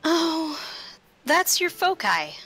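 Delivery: ordinary talk, nothing marked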